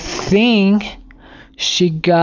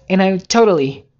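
usual speaking voice

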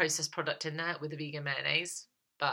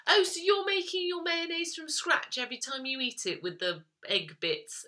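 sarcastic retort; 12 semitones higher than usual. Pretend. Answering critics of vegan mayo, in a sarcastic mock retort. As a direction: needle them with fake politeness.

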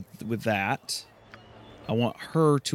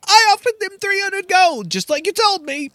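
higher, timid little helper; a higher, eager lackey squeaking out a reply; meek Garrick voice; 5 dB louder than usual. Acted. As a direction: pipe up eagerly like a nervous servant. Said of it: "And Garrick goes".

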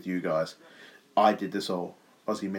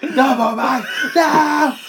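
high, loud laughing shriek of no; mock panic; 13 semitones higher, 6 dB louder, slower than usual. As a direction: shriek a hammed-up plea, laughing.

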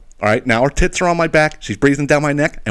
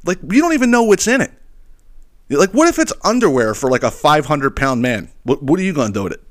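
higher, bewildered exasperation; a mock lecture to a package thief, much higher; exasperated; 7 semitones higher than usual. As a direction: rant at an imaginary thief in disbelief. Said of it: "I'm sitting here saying to myself".

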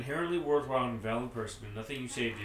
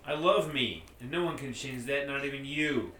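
defiant shout; 6 dB louder than usual. Acted. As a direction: shout it back proudly. Rob defiantly stands up.

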